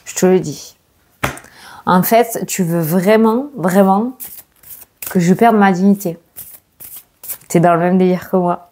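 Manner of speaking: usual speaking voice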